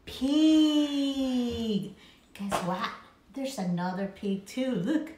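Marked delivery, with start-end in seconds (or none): none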